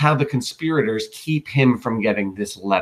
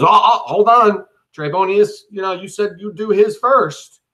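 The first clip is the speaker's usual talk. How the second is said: higher and louder, hurried cut-in; a flustered courtier butting in, pitched up; eager interruption; 8 semitones higher, 4 dB louder than usual. Acted. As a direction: jump in fast to grab Caesar's attention. Decius cuts in on Artemidorus.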